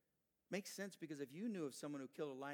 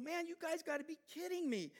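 incredulous scoff; 14 semitones higher, 7 dB louder than usual. Acted. David mocks the soldiers' fear.

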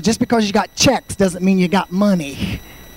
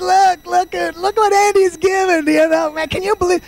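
much higher, jeering disbelief; mocking brother-in-law; 11 semitones higher than usual. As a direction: ridicule him in front of everyone. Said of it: "he's making fun".

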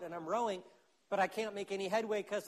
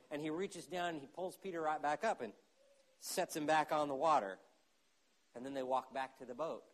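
ordinary talk

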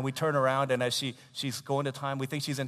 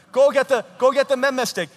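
high, very loud command; hammed-up parental order; exasperated parent; 12 semitones higher, 16 dB louder than usual. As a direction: demand it in mock outrage. Acting parent repeating threat.

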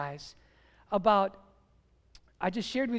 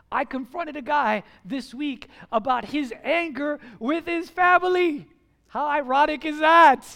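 preaching; 3 semitones higher, 6 dB louder than usual. Acted. Preacher rising to full force.

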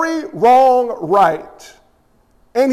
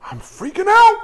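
high-pitched panicked outburst; freaking out; 10 semitones higher than usual. Acted. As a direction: play David losing his nerve. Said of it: "why are you freaking out".

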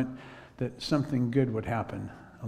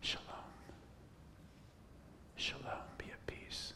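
whispered blessing; 20 dB quieter than usual. Retold or imitated. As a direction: breathe it gently into her ear. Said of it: "I just whispered that in her ear".

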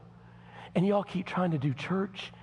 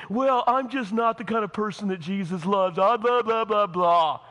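higher, louder mock self-pity; mocking whiner; 4 semitones higher, 5 dB louder than usual. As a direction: mimic a sulky churchgoer. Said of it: "and you're sitting".

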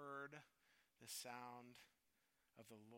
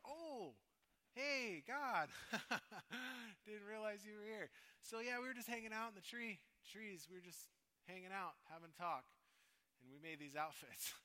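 higher, louder, nervous laugh, faux-casual; sheepish caught voice; 7 semitones higher, 6 dB louder than usual. Adam caught hiding, greeting God with faux-casual nervousness. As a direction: play it cool though you got caught.

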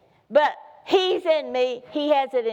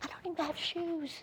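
hammed-up crying complaint; mock whimper; 7 semitones higher than usual. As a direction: whine like a kid in last place. Last place without shoes.

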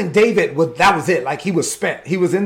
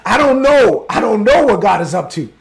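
loud uncertain plea; 3 semitones higher, 6 dB louder than usual. Acted. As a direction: push it out as a desperate cry. David voiced, unsure of God's plan.

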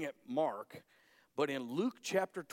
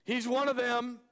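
loud high shouted accusation; a bystander shouting the charge; accusing shout; 10 semitones higher, 6 dB louder than usual. Acted. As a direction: point him out and yell it.

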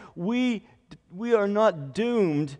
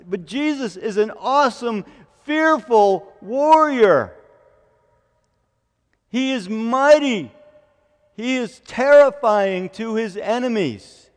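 full-force preaching; 5 semitones higher, 8 dB louder than usual. Real, not acted. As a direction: build to a thundering proclamation of might.

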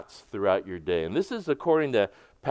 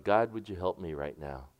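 much softer, a quiet private plea to God; hushed prayer; 6 semitones lower, 6 dB quieter than usual. Shown as a demonstration. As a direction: pray it under your breath, softer and lower. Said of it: "you would whisper these things like".

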